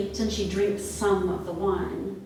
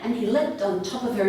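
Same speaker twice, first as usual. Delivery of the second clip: ordinary delivery, nothing marked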